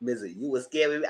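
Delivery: usual speaking voice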